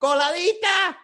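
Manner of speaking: high, hammed-up proclamation; defiant Miami vendor. Miami seller unmoved by the end of gasoline, high and defiant.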